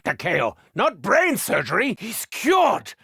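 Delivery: usual speaking voice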